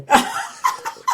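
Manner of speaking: high, airy burst of laughter; a breathy giggle. Real, not acted. Laughs at the remark.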